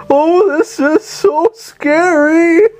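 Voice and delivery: high, slow, hammed-up fake fright; villain mockingly whining about the falling ceiling, high and slow; mock scared villain. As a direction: play a lunatic pretending to be scared, way up high and drawn out. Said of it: "He's like".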